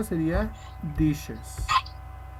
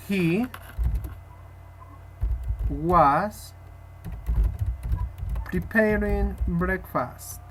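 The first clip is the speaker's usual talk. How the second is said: word by word with long pauses, "preparing breakfast" drawn out; slow dictation; much slower than usual. Shown as a demonstration. Teacher dictating the answer to her student, slowly. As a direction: dictate each word so it can be written.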